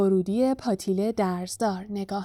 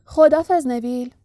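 louder, lively farewell; cheerful conductor; 3 semitones higher, 6 dB louder than usual. A narrator's reading. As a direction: call goodbye brightly to the departing passenger.

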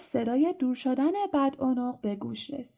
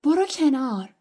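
louder, raised, curt order; aggressive; 4 semitones higher, 6 dB louder than usual. A narrator's reading. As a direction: order him out of the way angrily. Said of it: "روم با حالت پرخاشگرانه گفت". (پرخاشگرانه و بلند)

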